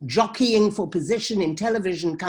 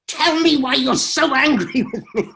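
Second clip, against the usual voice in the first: high, loud yell breaking into laughter; mock shouting; 6 semitones higher, 5 dB louder than usual. Filmmaker retelling cat fight on the panel, laughing mock shout. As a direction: yell it hammed-up, then crack up. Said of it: "I'm screaming my head off".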